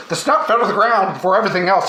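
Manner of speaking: ordinary delivery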